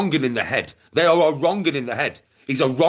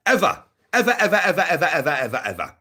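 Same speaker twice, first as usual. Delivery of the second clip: rapid-fire run, starting high and sliding down; machine-gun repetition, descending in pitch; emphatic repetition; 3 semitones higher, faster than usual. Real, not acted. Hammering denial by rapid repetition.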